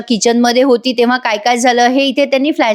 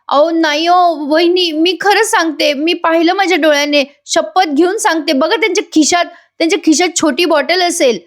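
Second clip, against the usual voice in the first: high-pitched retold protest; insistent pleading; 6 semitones higher than usual. Asha swears she saw it.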